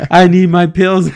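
loud, fast, half-laughing panic; a friend mock-panicking at the border, loud and fast; panicked friend. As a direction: blurt it out like she's freaking out. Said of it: "she's like".

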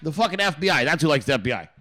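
normal talking voice

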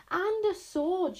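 high, loud, slow proclamation; shouted. A narrator's reading. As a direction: draw it out like a battle cry. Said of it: "shouted Wish".

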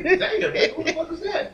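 retold laughing disbelief. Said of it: "I'm like".

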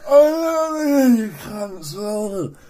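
put-on high, slurred drunk yelling; imitated drunken holler at housemates; drunken shouting. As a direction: bawl it drawn out and wordless like a smashed neighbour. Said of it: "just shouting at his housemates".

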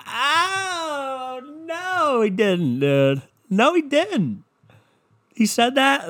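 drawn-out high "oh no"; hammed-up scandalized disbelief; mock shock. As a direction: wail it high like fake outrage. Mock shock at the star's remark.

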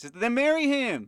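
high, loud exasperated yell; exasperated shout. Real, not acted. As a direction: throw up your hands and yell it. Exasperated at the show.

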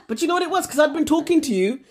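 normal talking voice

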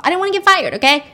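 defensive outburst. Retold or imitated. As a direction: blurt it out when cornered. Cornered doctor blurts defensively.

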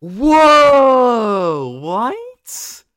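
loud, high shocked whoa. Real, not acted. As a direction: blurt it out in disbelief. Shocked reaction to ranking.

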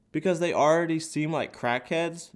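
ordinary talk, nothing marked

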